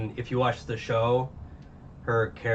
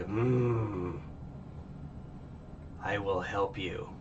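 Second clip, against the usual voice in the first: tag; 2 semitones lower, 7 dB quieter, much slower than usual. Retold or imitated. Acting out hero's solemn answer.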